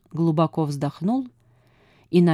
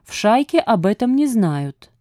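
usual speaking voice

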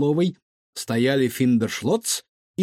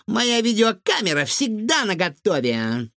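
put-on high, gleeful girl's voice; eager exclamation; 9 semitones higher than usual. A narrator's reading. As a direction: announce it proudly, high-pitched. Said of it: "воскликнула Лиза".

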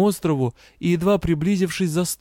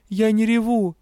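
voice trembling; 8 semitones higher than usual. Acted. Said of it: "голос дрогнул".

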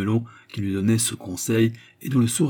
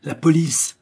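raised, higher warning cry; loud, urgent announcement; shouted alarm; 6 semitones higher, 5 dB louder, slower than usual. A narrator's reading. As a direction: call out an alarm down a corridor. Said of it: "une voix cria dans le couloir".

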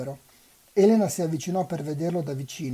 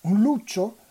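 "luccio" lifted high and louder in alarm; surprised question; 5 semitones higher, 7 dB louder than usual. A narrator's reading. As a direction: echo the word in startled disbelief. Elena repeats the word, startled. (sorpresa, "luccio" detto più alto e forte)

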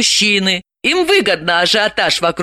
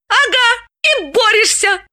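exclaiming. A narrator's reading. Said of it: "Далила воскликнула".

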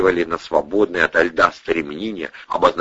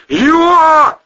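very high, loud drawn-out yell; full-throated high howl; yelling from icy shock; 8 dB louder than usual. A narrator's reading. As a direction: bellow as icy water hits. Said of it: "орал он".

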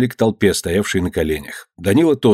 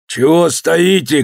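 high, drawn-out urging cry; shouting; 7 semitones higher, slower than usual. A narrator's reading. Nadeika urging silent workers, high shout. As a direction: rouse them with a raised voice. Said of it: "крикнул Надейка".

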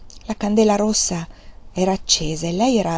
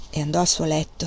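ordinary delivery

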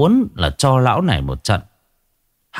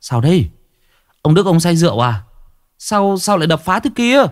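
higher, rising alarmed shouts to the neighbours; calling out in alarm; 9 semitones higher than usual. Acted. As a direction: raise the alarm, fast and urgent. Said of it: "vội vàng hô hoán".

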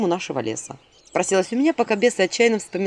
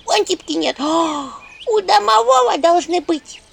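high squeaky imp voice; little imp voice; 9 semitones higher, 5 dB louder than usual. Acted. Imp Anchutka quickly saying she has none. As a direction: chirp it like a small imp.

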